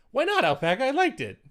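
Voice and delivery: high playful protest; excited. Real, not acted. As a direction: squeal it out in mock outrage.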